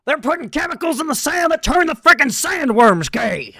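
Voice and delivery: high, loud, ranting shout; a frantic conspiracy rant, yelled high; Alex Jones impression. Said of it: "Alex Jones and Dune".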